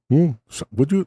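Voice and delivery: botched Indian accent imitation; failed Indian accent. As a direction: try an Indian accent and fumble it through laughter. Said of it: "I can't do an Indian accent".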